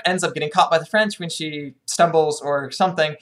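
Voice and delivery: ordinary talk, nothing marked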